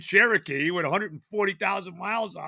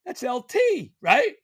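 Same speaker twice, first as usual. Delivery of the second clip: much higher, climbing excited question; excited surprise; 4 dB louder, slower than usual. Retold or imitated. Husband spotting Lawrence Taylor at the course, excited high surprise. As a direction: squeal it like you just spotted a star.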